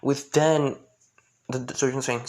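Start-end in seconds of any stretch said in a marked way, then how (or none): none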